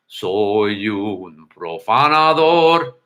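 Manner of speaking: slow, low crooning rock singer. Retold or imitated. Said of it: "but then he goes".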